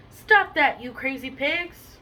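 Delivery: loud, high burst on the first words, then drawn out; storybook yell. A narrator's reading. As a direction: scold the pigs in a read-aloud yell. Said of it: "yelled Mister Fenster".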